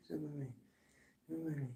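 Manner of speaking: very soft, under the breath; trailing disclaimer. Real, not acted. Reader disowning the outburst, muttered very quietly. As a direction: shrink it down to a tiny aside.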